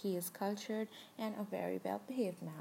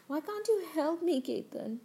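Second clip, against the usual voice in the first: high, loud pleading cry to her husband; desperate plea; 8 semitones higher, 7 dB louder than usual. Acted. Wife begging husband Ketan for help, loud desperate plea. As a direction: beg him, voice pitched up and loud.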